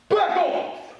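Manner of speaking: loud, high commanding shout; strong voice. Shown as a demonstration. Teacher drilling kids on warning the bad guy, loud shout. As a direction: bark it at the villain. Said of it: "drill phrase".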